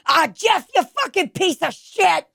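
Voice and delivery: put-on high, laughing Bronx-accent insult; Susie's Bronx accent. Host imitating Susie insulting husband Jeff, Bronx accent. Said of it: "Susie's accent".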